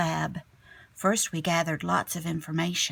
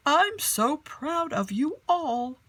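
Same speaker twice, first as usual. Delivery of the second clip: slow, higher proud teacher voice; beaming teacher; 7 semitones higher than usual. Acted. Storyteller voicing Mister J praising the class, slow and proud. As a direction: let Mister J beam with pride, slowly. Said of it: "Mister J beamed".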